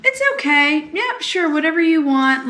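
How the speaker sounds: high-pitched, accommodating voice; agreeable people-pleaser. Retold or imitated. People-pleaser giving in to others, high and agreeable. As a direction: give in readily, pitched high.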